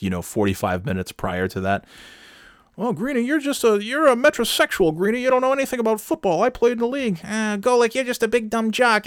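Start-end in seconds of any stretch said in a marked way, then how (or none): 2.8-7.2 high squeaky mock host voice, fast; mocking sports radio host. 7.2-9.1 high squeaky mock comeback; mocking sports radio host. Mocking the other host's reply.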